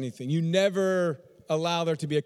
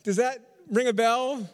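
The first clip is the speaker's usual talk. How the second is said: high, louder, laughing question to the crowd; knowing, amused and pitched up; amused teasing; 5 semitones higher, 3 dB louder than usual. Real, not acted. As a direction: tease the congregation through a laugh. Teasing congregation after pointed example, laughs.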